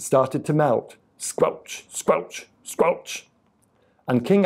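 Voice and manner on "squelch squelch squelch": whispered, wet squelch sounds; three separate squishy steps, mostly voiceless; squelching boots. A narrator's reading. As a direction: squelch like soggy melting boots. Voicing melting boots as sound effect.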